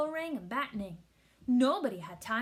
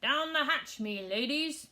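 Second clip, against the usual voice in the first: louder, higher pirate toast; pirate yell; 3 semitones higher, 4 dB louder, slower than usual. A narrator's reading. Captain toasts at dinner, mild pirate yell. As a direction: bang the table and bellow lightly. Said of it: "pounded his fist on the table and yelled".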